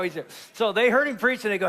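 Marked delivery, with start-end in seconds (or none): none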